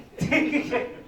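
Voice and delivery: brief real chuckle at his own joke; short amused laugh from the pulpit. Real, not acted. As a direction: let a quick laugh slip out.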